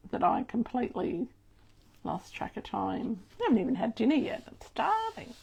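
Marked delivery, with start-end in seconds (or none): 4.5-5.3 breathy high whimper. Acted. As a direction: whine it like a hungry child. Complaining she hasn't had dinner.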